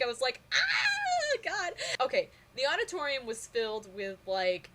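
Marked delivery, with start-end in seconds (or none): 0.5-1.7 laughing squeal. Retold or imitated. Retold squeal at star.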